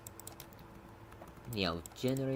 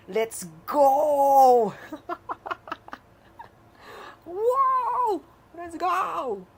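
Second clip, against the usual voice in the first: high, loud whoops of joy; triumphant cheer; 13 semitones higher, 8 dB louder, much slower than usual. Real, not acted. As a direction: celebrate like you just won. Code finally works, celebrating.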